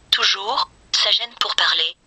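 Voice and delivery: usual speaking voice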